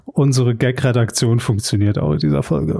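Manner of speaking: normal talking voice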